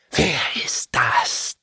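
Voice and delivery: slow, voiceless hiss; hissing whisper. A narrator's reading. Fallen angels hissing at the intruder, whispered. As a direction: breathe it out like a suspicious demon.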